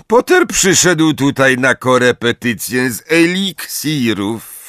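mocking character voice; sneering teacher. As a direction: announce it pointedly in a put-on teacher's voice. Snape taunts pupil before Malfoy.

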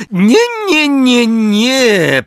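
very high, shrieking excited no; barely contained glee. A narrator's reading. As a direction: hold back thrilled glee. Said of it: "z trudem panując nad podnieceniem". (piskliwe, podniecone „nie”)

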